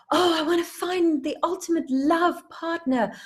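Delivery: high, dreamy gush; gushing wish. Acted. Person telling puppy their love wish, gushing.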